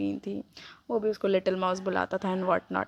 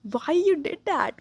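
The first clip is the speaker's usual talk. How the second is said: halting, very slow, pitch much higher; stammered question, dragged out and higher; stuttering; 7 semitones higher, 3 dB louder, much slower than usual. A narrator's reading. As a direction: stumble over the words in shock. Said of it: "stutter करते हुए पूछा".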